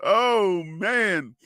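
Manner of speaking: normal talking voice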